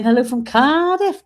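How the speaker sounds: a rough, playful Welsh accent. Shown as a demonstration. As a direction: put on a cheesy Welsh lilt for Cardiff. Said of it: "is that my good Welsh accent".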